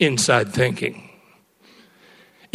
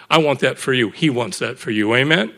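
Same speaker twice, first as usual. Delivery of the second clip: usual speaking voice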